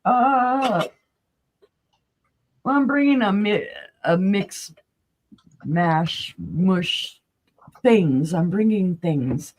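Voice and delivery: sick creaky voice with a cold. Real, not acted. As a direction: croak through a creaky throat. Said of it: "I'm like all creaky voice".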